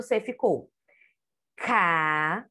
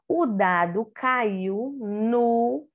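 slow reading aloud; much slower than usual. Shown as a demonstration. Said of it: "C com A".

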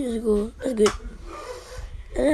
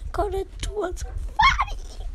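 whiny shout; 9 semitones higher, 4 dB quieter than usual. Real, not acted. Complaining about pull.

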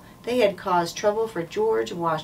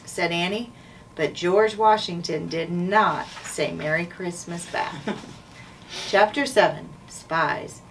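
ordinary delivery, nothing marked

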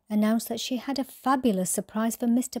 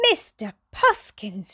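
gasped. Acted. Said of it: "she gasped".

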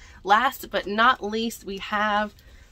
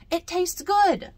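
put-on delighted child; 10 semitones higher, 5 dB louder than usual. Abby delighted.

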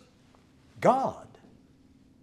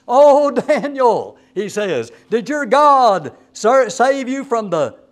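slow, much louder and higher anguished cry into the dark; lamentable voice; 6 semitones higher, 9 dB louder, slower than usual. Retold or imitated. King hollering down to Daniel at dawn, loud slow lament. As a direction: wail it down the pit, drawn out. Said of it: "he said in a lamentable voice".

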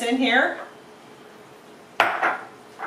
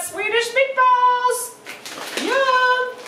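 high, held, sung-out cheer; gleeful high sing-out; triumphant announcement; 14 semitones higher, much slower than usual. Real, not acted. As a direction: announce it climbing to long high notes.

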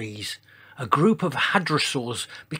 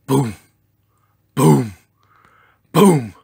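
booming footsteps; 10 dB louder, slower than usual. A narrator's reading. Narrator imitating a giant's footsteps, booming. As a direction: sound out each heavy thud. Heavy footsteps of approaching dinosaur.

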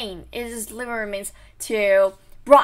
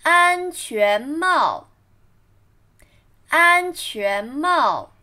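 slow model reading for learners; practicing tones; slower than usual. Shown as a demonstration. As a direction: say 安全帽 slowly and clearly. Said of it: "read after me".

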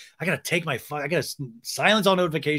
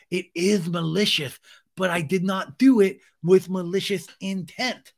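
higher-pitched lispy mock defense; Sandoval; 5 semitones higher, 3 dB louder than usual. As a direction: defend yourself in a put-on lisp. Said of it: "Sandoval's like".

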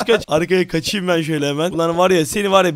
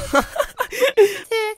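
high laugh. Real, not acted. Laughing at becoming mom.

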